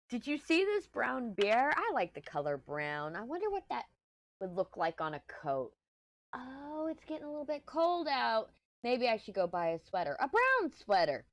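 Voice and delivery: mock stream of drifting thoughts, pitch swooping high and dropping; lilting, wandering musing, stretching some words; scatterbrained rambling. Explaining ADHD to the girls by example, playfully scatterbrained. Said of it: "the hard thing is finishing a thought".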